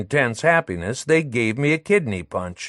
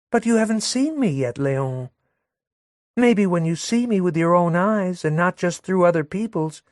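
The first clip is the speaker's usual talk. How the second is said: put-on higher sweet young woman with a barbed edge; Madelon, sweet and sly; 9 semitones higher than usual. Madelon cooing at Léon while jabbing the narrator, higher sweet voice. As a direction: coo at him, then slip in a dig.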